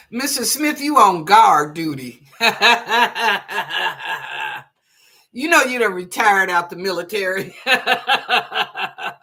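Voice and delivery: can't stop giggling while teasing her; real laughter. Real, not acted. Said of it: "don't know why I'm laughing".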